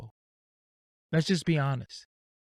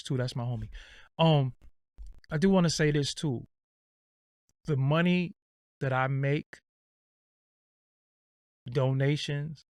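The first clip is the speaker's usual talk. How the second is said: normal talking voice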